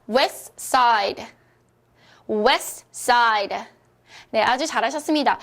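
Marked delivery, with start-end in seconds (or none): 0.1-3.6 "West Side" modelled as wes, side, with no T; dropped T. Shown as a demonstration. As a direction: say "West Side" for learners to repeat, T left out. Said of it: "우리 한번 소리 크게 내볼게요". ("West Side"를 T 없이 또박또박 따라 하게)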